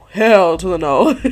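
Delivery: loud hammed-up refusal with laughter; mock defiant outburst. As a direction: belt out a refusal, half laughing. Cam refuses, acted with laugh.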